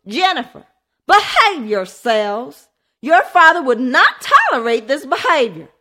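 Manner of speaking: high, loud motherly scolding. A narrator's reading. Mother scolding her fighting children, loud. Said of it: "Melissa Bannister scolded".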